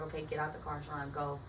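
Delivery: low, soft self-coaxing; talking to herself. Retold or imitated. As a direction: quietly nudge yourself along like an inner voice. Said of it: "I'm talking to myself".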